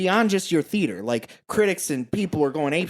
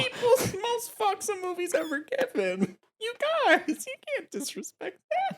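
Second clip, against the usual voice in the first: high mock announcer; 5 dB quieter than usual. Mock grand announcer pitch.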